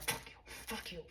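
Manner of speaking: whispered, retold road-rage swearing; cursing under the breath. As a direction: spit it out silently at other drivers. Acting out private anger at drivers.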